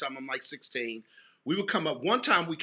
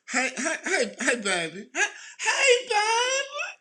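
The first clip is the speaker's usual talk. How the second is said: put-on high cackling old grandmother greeting, much higher and louder; imitating grandma; 5 dB louder, slower than usual. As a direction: squeal hey baby like a doting granny. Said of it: "she would literally be like".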